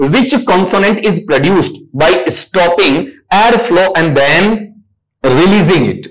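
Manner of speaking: slow, measured read of the exam question. Shown as a demonstration. Teacher reading the exam question to students, slowly. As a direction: read each word out slowly. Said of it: "reads the question aloud".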